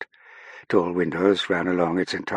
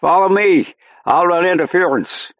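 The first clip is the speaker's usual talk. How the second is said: high, full-voiced shout; shouted command; 12 semitones higher, 8 dB louder than usual. A narrator's reading. Airman clearing a path for Saffron, shouting. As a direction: shout for her to follow through the crowd. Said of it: "shouted back, then added".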